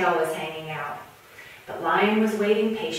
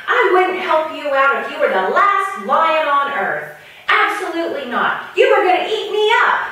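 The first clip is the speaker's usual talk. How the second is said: indignant refusal; 7 semitones higher, 7 dB louder than usual. Acted.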